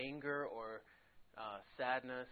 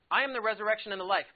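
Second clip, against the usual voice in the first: I am the resurrection and the life, louder, higher and fast; emphatic proclamation; 8 semitones higher, 13 dB louder, faster than usual. Acted. Teacher driving home Jesus' words to the class, loud and fast.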